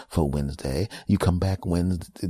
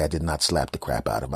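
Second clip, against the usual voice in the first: ordinary talk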